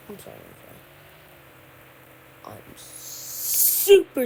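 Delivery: low growling demon voice. Pretend. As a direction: drop into a deep demon voice. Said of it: "Did I sound like a demon?".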